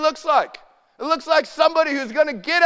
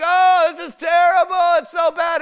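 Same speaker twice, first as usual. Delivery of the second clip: higher, louder mock panic; 6 semitones higher, 4 dB louder than usual. Preacher imitating fearful churchgoers, hammed-up panic. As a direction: ham up the worried cry of doom. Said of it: "And like".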